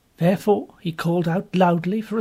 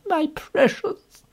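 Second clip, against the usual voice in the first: higher, slow coo of a fond woman; lightly voiced fussing nurse; 12 semitones higher, much slower than usual. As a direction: gently coo over the girl. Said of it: "calling her".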